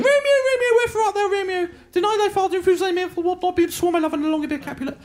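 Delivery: very high, rattled-off Juliet recital; fluent girl Juliet. Acted. Classmate reading Juliet perfectly, high and fluent. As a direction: breeze through Shakespeare in a high girlish voice. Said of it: "she was doing it perfectly".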